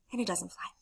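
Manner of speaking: usual speaking voice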